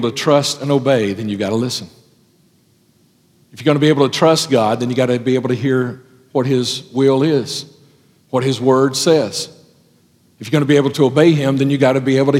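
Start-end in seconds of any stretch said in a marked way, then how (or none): none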